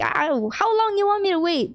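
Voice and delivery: high-pitched exasperated outburst; mock super-angry. Visitor tired of waiting, acted exasperation. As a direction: play indignant without real shouting. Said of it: "he got, like, super angry".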